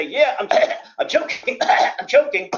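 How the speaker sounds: mock choking victim gasping out the words; imitating a choking victim. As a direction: act out someone mid-choke, hamming the coughs. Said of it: "if they say".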